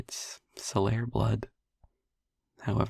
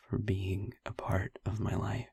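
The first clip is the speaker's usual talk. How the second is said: soft whisper; 4 dB quieter than usual. Acted. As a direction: whisper it close, like a secret confession. Tender confession to the listener.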